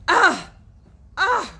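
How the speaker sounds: loud, high groaning; disgusted groans. Acted. Tom groaning on the floor, loud disgusted groans. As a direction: groan hard twice.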